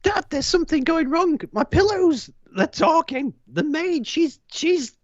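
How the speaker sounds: put-on high, frightened boy voice; a scared boy blurting to his father; panicked child. Young Cyril telling his father in a panic about the talking pillows.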